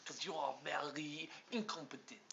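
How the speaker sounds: mock-French lilt, trailing to a hush; put-on French accent. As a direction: tease in a showy French accent. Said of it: "I'm the one who does accents".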